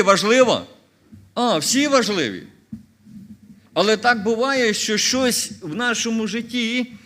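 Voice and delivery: rising into loud preaching, each question hammered; forceful preaching. Acted. Preacher drilling the congregation on each commandment, loud and forceful. Commandments listed, asked rhetorically.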